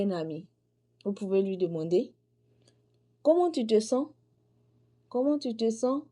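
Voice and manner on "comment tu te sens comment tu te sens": "Comment tu te sens?" with the voice rising on "sens"; questioning tone. Shown as a demonstration. Teacher showing students how to ask a friend "Comment tu te sens?" with a rise on "sens". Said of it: "le ton d'interrogation".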